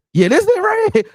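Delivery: mock the coaches' careless approval. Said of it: "And then be like".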